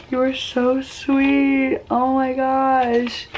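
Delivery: high, drawn-out, touched coo; about to cry. Real, not acted. Vlogger thanking friend for her letter, about to cry. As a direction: stretch it out, close to tears. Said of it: "I'm gonna cry".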